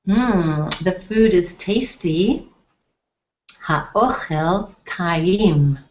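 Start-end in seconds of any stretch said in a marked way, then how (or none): 3.6-5.8 slow model pronunciation. Shown as a demonstration. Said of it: "teaching phrase slowly".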